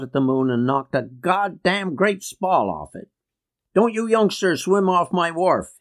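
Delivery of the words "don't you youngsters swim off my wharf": high-pitched, mildly bellowed warning; bellowing. A narrator's reading. Uncle warning youngsters off his wharf, mild bellow. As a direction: bellow it lightly in a raised pitch. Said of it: "he would bellow".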